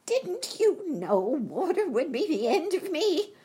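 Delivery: high, loud, despairing wail; wailing witch. A narrator's reading. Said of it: "in a wailing, despairing voice".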